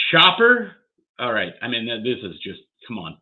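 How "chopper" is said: real high, loud burst on the name; excited call. Real, not acted. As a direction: call the card out, keyed up. Tense reveal of a card.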